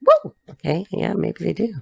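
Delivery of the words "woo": a sudden, very high whoop. Real, not acted. Cards jump out of the deck, excited whoop. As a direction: yelp with delight.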